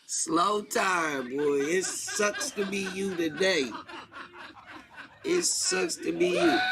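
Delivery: high, breathy giggling mockery; laughing taunt. Real, not acted. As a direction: cackle the taunt at him through laughter.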